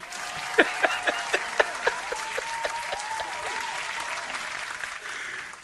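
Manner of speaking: wheezing silent laugh; airy wheeze of a laugh; breathless high laugh. Real, not acted. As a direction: laugh without voice, high and long. Laughing at the crunch.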